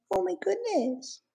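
very high, slow, drawn-out oh my goodness; high slow parrot demo for the bird to copy; parrot voice model. Shown as a demonstration. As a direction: coax the bird by sounding like it. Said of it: "Can you say".

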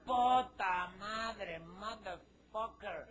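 long, stretched swear; slow, loud curse fading into a laugh; drawn-out curse. Acted. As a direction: drag out the dismay. Ant realizes Mercedes gone.

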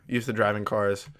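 ordinary talk, nothing marked

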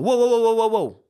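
high, loud rapid-fire whoas; panicked shout. Retold or imitated. Dad yelling at his running daughter about a cup, panicked shout. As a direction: re-enact a panicked warning yell. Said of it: "I did raise my voice".

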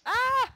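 high, loud "ah" scream; game scream. Real, not acted. Player reacting to an attack, high scream.

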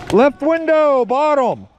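loud high callout; high shout. Real, not acted. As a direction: yell the enemy position high. Calling enemy position.

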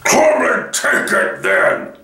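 slow, rough bull's bellow. A narrator's reading. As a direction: bellow it slowly like a great beast. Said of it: "in a great bellowing voice".

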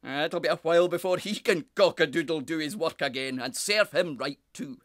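loud, indignant bluster; roaring big wind. A narrator's reading. Big brother scoffs at the fallen cockerel, roaring. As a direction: boom it out gloatingly. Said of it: "roared Walter Wind".

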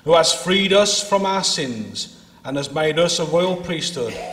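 ordinary talk, nothing marked

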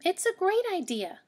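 put-on high, bright pleading kid voice; child rabbit backing up the plan; eager child. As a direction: insist like a hopeful little bunny.

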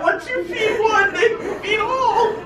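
high squeaky words through real laughter; can barely speak for laughing. Real, not acted. Laughing hysterically at the seagulls.

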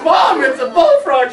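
high, loud protest; insistent shout. Retold or imitated. Boy assuring his mother, loud and insistent. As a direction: insist it's a frog. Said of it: "And I said".

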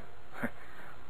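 brief breathy laugh; quiet carefree chuckle; soft chuckle. Acted. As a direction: laugh it off lightly. Artemio laughs off being dismissed.

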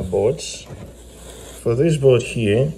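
normal talking voice

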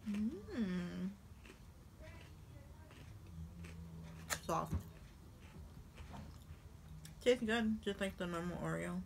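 chewing while talking; talking through cookie bite; mouth full. Real, not acted. Seen 1: bites at -1.0, closed mouth chewing, cheeks full while speaking.

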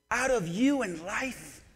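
ordinary delivery

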